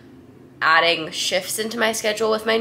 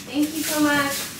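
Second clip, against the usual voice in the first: sweet thanks; 9 semitones higher, 3 dB quieter than usual. Real, not acted. Thanking the delivery person.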